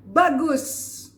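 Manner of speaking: high, loud exclamation of approval; emphatic praise intonation. Shown as a demonstration. Coach praising keen learners, loud emphatic approval. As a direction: praise it with a big emphatic burst. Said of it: "Itu intonasi".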